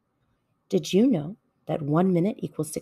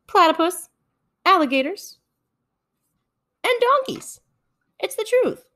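salesman pitch; 9 semitones higher than usual. Acted.

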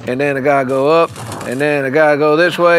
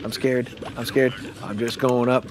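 soft fearful mumbling to himself; quiet scared murmur; 2 semitones lower, 8 dB quieter than usual. Acted. Player lost in the dark game, scared and murmuring softly. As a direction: murmur like a frightened child lost.

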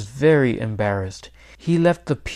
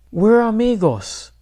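put-on higher, impassioned boy's protest; crying out; 9 semitones higher, 4 dB louder than usual. A narrator's reading. Boy defends his pet turkey to his grandparents, crying out. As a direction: cry it out in protest, pitched up. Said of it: "cried Miguel".

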